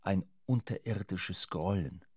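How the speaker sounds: ordinary talk, nothing marked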